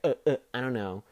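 hammed-up clueless freshman mumbling an excuse; mocking the kid. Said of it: "is always like".

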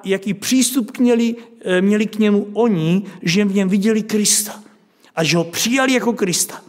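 ordinary delivery

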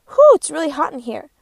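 a loud, hammed-up "Whew" from an overheated muffin; hot muffin voice. First muffin in the joke complaining loudly about the oven heat. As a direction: blow out the heat like a sweaty muffin. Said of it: "The first muffin says".

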